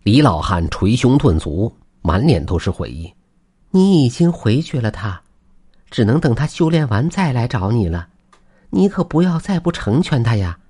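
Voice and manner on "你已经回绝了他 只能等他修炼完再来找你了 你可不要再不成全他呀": high-pitched wife, pleading patiently; well-meaning wifely admonishment; earnest urging. Acted. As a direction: urge them in a raised, concerned voice. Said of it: "王氏苦口婆心地劝道".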